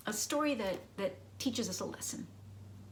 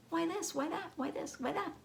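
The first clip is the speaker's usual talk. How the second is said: put-on higher, quick questioning child voice; curious child; 10 semitones higher than usual. Teacher imitates her childhood self pestering with questions, high child voice. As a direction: pester like a nosy little kid. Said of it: "I asked so many why questions".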